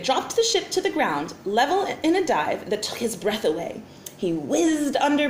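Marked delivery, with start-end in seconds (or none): none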